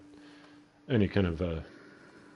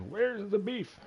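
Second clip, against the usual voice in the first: put-on much higher, thin old-lady squeak; angry old lady from the commercial; much slower than usual. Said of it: "it was that old lady in the commercial".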